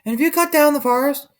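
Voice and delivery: very high small voice; porcupine voices. A narrator's reading. Porcupines speaking to the sleeping man, very high. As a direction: squeak it like a little porcupine. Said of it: "and whispered to the man".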